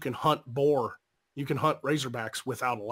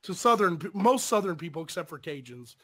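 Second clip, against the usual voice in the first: ordinary talk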